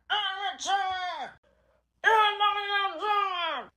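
high, slow angry shout; crying-shouted denial; shouted quarrel. Pretend. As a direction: yell it like a furious toy character. Toy characters quarrel, shouting.

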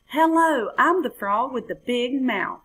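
high, loud cheeky hello; saucy frog. Acted. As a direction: skip in cheekily. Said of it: "he said with a saucy skip".

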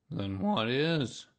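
slow doleful donkey question; gloomy donkey. A narrator's reading. Donkey asking bear what is there, gloomily.

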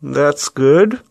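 phone held in mouth, words muffled; talking through clenched teeth. Real, not acted. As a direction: speak around an object between teeth. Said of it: "I'm holding the phone with my mouth".

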